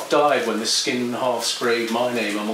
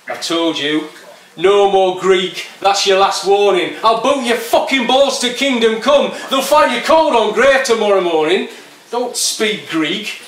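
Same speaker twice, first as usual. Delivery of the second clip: loud, higher threatening yob; menacing skinhead; 8 semitones higher, 6 dB louder than usual. Acted. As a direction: bark the warning like a Leeds skinhead ready to fight. Poet voices the skinhead.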